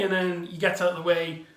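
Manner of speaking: normal talking voice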